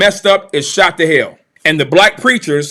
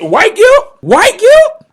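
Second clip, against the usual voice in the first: incredulous; 13 semitones higher, 4 dB louder, much slower than usual. Real, not acted. Incredulous repeat of the phrase.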